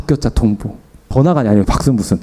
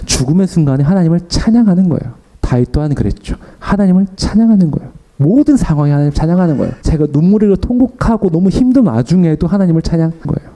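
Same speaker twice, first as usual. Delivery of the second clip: raised, ringing preacher's voice; fervent preaching; 5 semitones higher than usual. Acted. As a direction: proclaim it to the congregation. Preaching climax.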